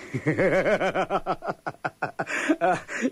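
long, high, rippling laugh; gleeful cackle. Acted. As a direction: cackle greedily over the money. Cellmate laughs as he gets paid.

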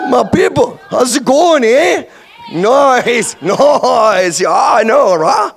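put-on loud, high, broad Canadian accent; hammed-up Canadian. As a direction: greet fellow Canadians with an exaggerated twang. Greets fellow Canadians in hammed-up Canadian voice.

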